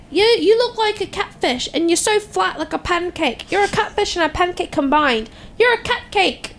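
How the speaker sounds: high-pitched mock British insult; British accent. Teasing her sister with insults in a mock British accent. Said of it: "I used my British accent".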